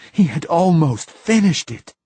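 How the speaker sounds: high, loud anguished repeat of He. A narrator's reading. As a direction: say it again, breaking in despair. Repeated line building despair.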